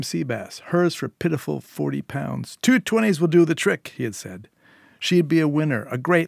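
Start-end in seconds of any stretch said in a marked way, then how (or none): 2.6-3.9 higher, louder sales patter; hawker's pitch. A narrator's reading. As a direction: wheedle the money out of her. Conman hawking fish.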